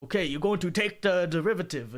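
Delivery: put-on Korean-accented teacher voice; imitated Korean accent. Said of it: "this Korean guy who was like".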